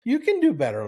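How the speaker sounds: put-on high child's scold; high little-girl scold recalled as a motto. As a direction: echo the little girl's high finger-wagging line. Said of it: "And I think of that all the time".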